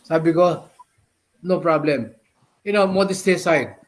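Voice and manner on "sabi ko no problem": ordinary talk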